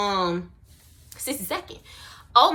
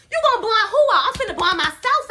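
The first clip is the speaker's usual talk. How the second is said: incredulous laugh; 8 semitones higher, 3 dB louder, faster than usual. Real, not acted. Laughing at friend's offer.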